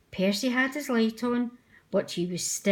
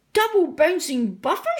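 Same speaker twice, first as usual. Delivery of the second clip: high, loud frightened yell; frightened shout; 7 semitones higher, 6 dB louder than usual. Acted. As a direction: shout it out in a panic. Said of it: "shouted Percy".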